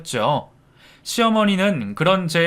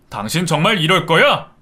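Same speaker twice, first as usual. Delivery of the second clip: threatening husband; 4 dB louder than usual. A narrator's reading. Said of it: "때릴 것처럼 손을 들어 올렸죠".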